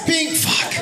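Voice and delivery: high, quick mock honk with a curse; a mock honk that ends in a curse; imitating the driver's honk. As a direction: play the driver slapping the horn. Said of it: "He went just like this".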